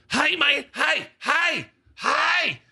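high-pitched insistent shout; calling out. Retold or imitated. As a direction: holler for attention from the fence. Angry fan calling from fence line.

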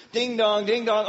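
high, loud sung ding dong; doorbell imitation. Preacher imitating the doorbell, high and loud sing-song. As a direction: chime twice like a doorbell.

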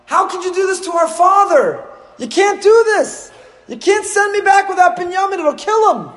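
high, loud desperate outcry; pleading shout. Acted. Yehuda shouting at Yosef to spare their father, desperate loud cry. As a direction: yell it up high, begging for a father.

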